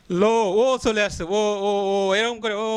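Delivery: ও held and repeated, stretched; drawn-out vowel. Shown as a demonstration. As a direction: stretch the ও out on one breath. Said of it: "লম্বা চলতে আছে". (ও ধ্বনি টেনে টেনে লম্বা করে বলা)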